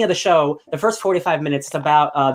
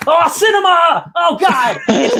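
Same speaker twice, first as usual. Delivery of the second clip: high, loud, stretched-out gushing shout; ecstatic outburst; 13 semitones higher, 5 dB louder, slower than usual. Retold or imitated. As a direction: cry it out like a fan overwhelmed by a movie. Gushing over a great scene.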